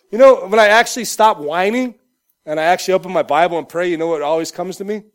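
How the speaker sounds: ordinary talk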